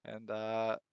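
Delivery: ordinary delivery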